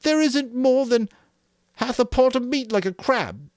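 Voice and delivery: very high and loud, bleating; Piggy's whine. A narrator's reading. Piggy grumbling to Jack, high and loud. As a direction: complain in a piercing high voice. Piggy complaining about meat.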